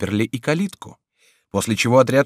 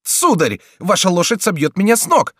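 much higher, loud frantic complaints; high panicky Friar; 10 semitones higher, 6 dB louder than usual. A narrator's reading. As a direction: shout at the riders in panic. Friar crushed in crowd.

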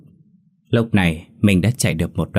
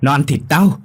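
panicked scream; 5 semitones higher than usual. A narrator's reading. Minh screaming Kim is demon.

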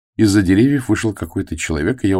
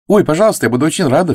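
high, louder eager exclamation; girl thrilled by a gift offer; delighted cry; 10 semitones higher, 6 dB louder, faster than usual. Acted. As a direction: burst out with joy. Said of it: "вскричала Флора".

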